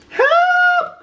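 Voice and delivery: very high, loud yelp; panicked cry. A narrator's reading. Cats fleeing the frankfurter, screeching. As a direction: screech for help. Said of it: "cried the cats".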